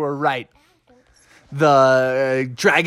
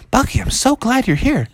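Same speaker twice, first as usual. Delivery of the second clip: relieved joy; 9 semitones higher than usual. Acted. Friends relieved after rescue.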